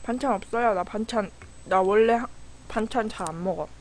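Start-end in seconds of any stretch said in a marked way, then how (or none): none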